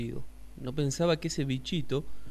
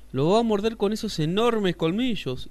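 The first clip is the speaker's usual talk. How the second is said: alarmada; 7 semitones higher, 7 dB louder than usual. Acted. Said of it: "dijo la iguana".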